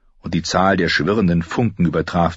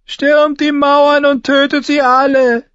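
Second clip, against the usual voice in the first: slow, high, loud order; drawn-out, thin-voiced command to attack; offhand order called out at high pitch; bored command; 6 dB louder than usual. A narrator's reading. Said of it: "befahl Sir Amory gelangweilt".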